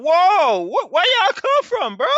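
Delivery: high, loud startled whoa. Acted. Abraham startled by three men at his tent, high loud shock. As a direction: jump back at strangers appearing.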